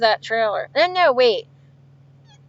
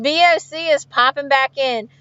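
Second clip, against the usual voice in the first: ordinary talk, nothing marked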